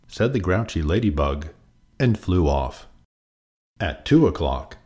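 plain reading voice

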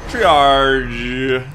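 TRIAGE loud and stretched, "tree-aaarzh"; mouth held open long. Shown as a demonstration. Following a viewer's advice on speaking, mouth held open long. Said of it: "keep your mouth open unnecessarily long while speaking".

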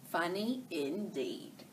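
ordinary delivery, nothing marked

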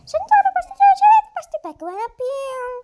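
high put-on voice.

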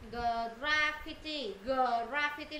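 "Graffiti" drawn out very slowly, syllable by syllable; slow demonstration. Shown as a demonstration. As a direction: take "Graffiti" apart at a crawl. Teacher models word slowly. (đọc "Graffiti" thật chậm, rõ từng âm)

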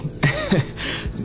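shy laugh. Acted. Boyfriend flattered by praise.

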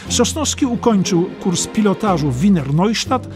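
ordinary delivery

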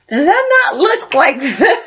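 high, delighted and giddy; excited. Real, not acted. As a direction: squeal over the food with a laugh. Excited about the noodles.

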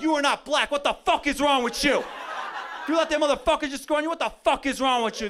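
high, loud furious scolding; angry yelling. Retold or imitated. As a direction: chew out the defender who got beat. Other team yelling at their guy.

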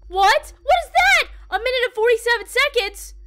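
high, loud startled questions; alarmed disbelief. Real, not acted. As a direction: blurt it out in shocked confusion. Startled by a sudden game event.

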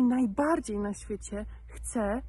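ordinary talk, nothing marked

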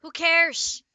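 high, loud dismissive shout; loud retort. Real, not acted. Reader dismissing a friend's correction, loud high retort. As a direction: yell it back, brushing off the correction.

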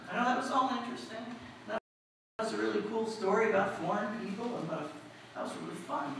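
ordinary talk, nothing marked

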